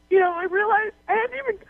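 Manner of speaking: high, weepy confession; really crying while talking; tearful. Real, not acted. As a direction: tell it through tears, pitch climbing. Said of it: "you had been crying".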